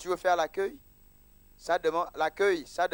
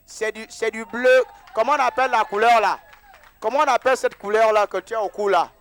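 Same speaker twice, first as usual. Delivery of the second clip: animated loud; 6 semitones higher, 13 dB louder than usual. Real, not acted. Pasteur s'anime devant l'assemblée.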